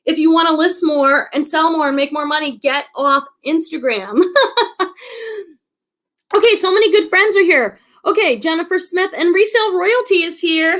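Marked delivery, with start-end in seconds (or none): 4.3-4.9 short, high burst of laughter. Real, not acted. Host amused by her own Instagram advice, laughing.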